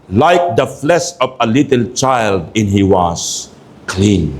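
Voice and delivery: slow, declaimed verse, high then falling; scripture reading. Acted. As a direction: read solemnly, stretching phrases and settling down at the end. Reads verse fourteen aloud.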